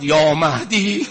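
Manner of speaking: loud sobbing call; crying out. Retold or imitated. Preacher crying out to Mahdi. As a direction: cry the name out with a sob. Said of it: "فریاد زد".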